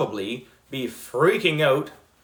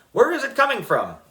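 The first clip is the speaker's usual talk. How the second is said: yelling teacher; 11 semitones higher, 3 dB louder, faster than usual. A narrator's reading. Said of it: "yelled Miss Child".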